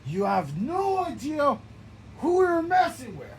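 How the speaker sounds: high, loud menacing call; loud threat. Acted. Character threatens an opponent, loud boast. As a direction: warn them with a loud boast.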